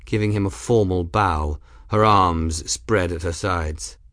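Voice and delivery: usual speaking voice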